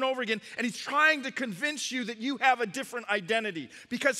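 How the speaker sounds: high, loud, driving sermon delivery; impassioned preaching. Real, not acted. Preacher warning of the enemy attacking identity, impassioned. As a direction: press the warning urgently on the congregation.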